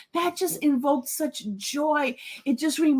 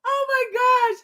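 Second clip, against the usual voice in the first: high, overcome sigh of delight; tearful joy; 10 semitones higher, 4 dB louder, much slower than usual. Real, not acted. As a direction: catch yourself after a crying laugh. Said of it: "I'm in tears, y'all".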